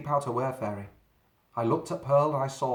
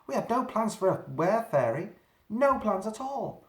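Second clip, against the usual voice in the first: panicking; 9 semitones higher than usual. A narrator's reading. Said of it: "panicking more".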